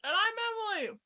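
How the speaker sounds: talking through a mouthful of kebab; words round a big bite of food; mouth full. Real, not acted. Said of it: "My mouth is so full".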